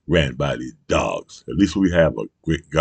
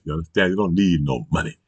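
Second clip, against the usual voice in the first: normal talking voice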